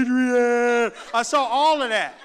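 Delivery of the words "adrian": loud, drawn-out movie-hero yell; Rocky. Retold or imitated. Boxer calling his love after a knockout, mock bellow. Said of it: "I saw Rocky going".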